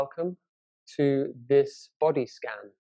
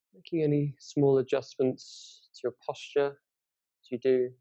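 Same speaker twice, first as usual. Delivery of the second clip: guided meditation. Acted. Teacher leads listeners through a body scan from the toes, guided meditation. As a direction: guide them unhurriedly through a body scan, leaving space between phrases.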